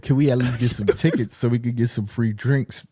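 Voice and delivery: low, dragging mock quote; mocking her words. Quoting a woman on the show, low mock voice. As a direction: parody her request lazily. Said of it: "She said".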